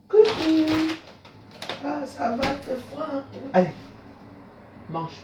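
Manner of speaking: tender coaxing while feeding the pigeons; talking to pigeons. Real, not acted. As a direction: coo gently to the birds.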